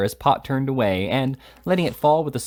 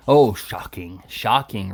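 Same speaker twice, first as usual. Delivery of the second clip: mock horror; 4 dB louder, slower than usual. A narrator's reading. Slurk mocking rival.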